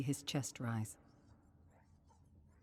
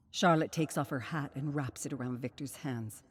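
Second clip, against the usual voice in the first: ordinary delivery, nothing marked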